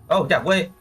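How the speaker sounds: usual speaking voice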